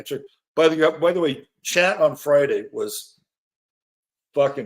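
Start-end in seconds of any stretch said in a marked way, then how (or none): none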